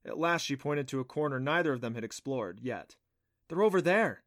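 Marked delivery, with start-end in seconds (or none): 3.5-4.2 raised, firm announcement; certain. A narrator's reading. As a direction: state it loudly with no doubt. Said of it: "she said with certainty".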